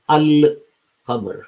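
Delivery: "al-qamar" slowly, with the L fully sounded; al pronounced in full. Shown as a demonstration. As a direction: give "al-qamar" a full, held L before the q. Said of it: "al is pronounced in full".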